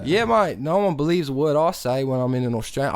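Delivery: put-on Aussie mate; Australian accent. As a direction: agree in a mock Australian accent. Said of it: "I love doing that accent".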